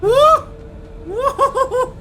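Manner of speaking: high, loud whoops of joy; excited cheer. Real, not acted. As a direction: cheer the win at the top of your voice. Cheering after winning the fight.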